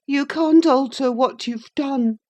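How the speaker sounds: put-on high, peevish boy reproach; high sickly boy being pettish. A narrator's reading. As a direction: sulk it at her. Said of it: "he replied pettishly".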